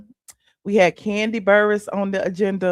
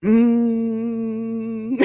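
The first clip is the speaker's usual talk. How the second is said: car hum. Shown as a demonstration. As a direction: hum like a silent motor. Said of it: "Let me shift gears".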